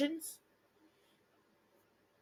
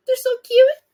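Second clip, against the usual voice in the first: much higher, louder squeal of delight; gushing; 9 semitones higher, 11 dB louder than usual. Real, not acted. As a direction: gush over something adorable. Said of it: "reader gushing over picture".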